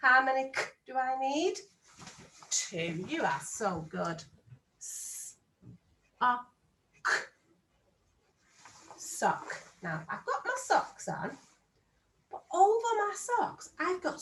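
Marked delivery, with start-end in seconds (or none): 4.8-9.6 "S-, a, K" one by one then "Sock"; sounding out. Shown as a demonstration. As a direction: spell it out, then blend "Sock". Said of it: "Let's sound out sock".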